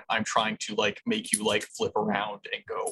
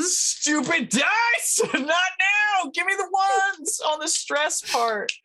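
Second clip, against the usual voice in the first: excited; much slower than usual. Real, not acted. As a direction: squeal at the dice to cooperate. Player begging the dice.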